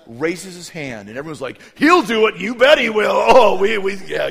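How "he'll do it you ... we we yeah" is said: put-on loud, high overlapping crowd of kids; clamoring kids. Kids volunteer Benny as bait, loud eager clamor. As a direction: shout it eagerly like a mob of children.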